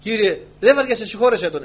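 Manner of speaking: loud, ironic mock prayer; sarcastic forgiveness, hammed up. Preacher mocks the pushy shopper with loud irony. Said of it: "Αλλά εγώ το είπα ειρωνικά".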